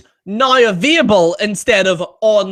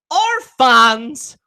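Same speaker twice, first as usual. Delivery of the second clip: ordinary talk, nothing marked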